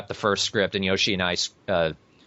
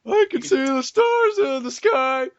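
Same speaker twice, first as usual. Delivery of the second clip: high dazed voice. Pretend. Playing dazed character.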